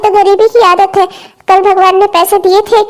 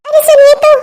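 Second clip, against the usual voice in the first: calling after husband; 7 semitones higher than usual. Acted. Wife calls after husband.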